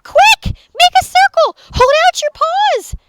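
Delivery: very high, loud rapid-fire orders; frantic little leader; yelling. A narrator's reading. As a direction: shout urgent rescue commands. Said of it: "yelled Pip".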